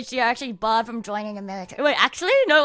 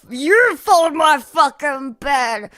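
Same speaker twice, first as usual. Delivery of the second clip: louder, slow defiant yell back; mock angry retort; 4 semitones higher, 9 dB louder, slower than usual. Imagined reply to the drill sergeant, loud mock outrage. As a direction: shout back at the sergeant, hammed-up. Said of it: "I'll probably just say some shit like".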